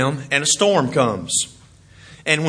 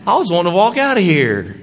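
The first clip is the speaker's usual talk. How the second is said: disappointed whine; 3 semitones higher than usual. Retold or imitated. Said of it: "And the man goes".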